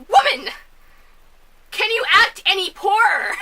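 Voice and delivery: loud, high mock outrage; exasperated rebuke. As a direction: berate her in disbelief. Exasperated rant at the actress.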